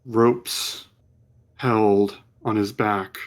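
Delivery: slow, hushed scene setting; measured storyteller voice; game master's vision narration. Acted. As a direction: draw them into the vision.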